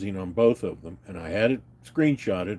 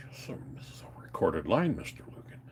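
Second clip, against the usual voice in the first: whispered warning; 4 semitones lower, 11 dB quieter than usual. Retold or imitated. The caller warning him the line is recorded, whispered. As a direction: hush it like a cold warning. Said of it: "He goes".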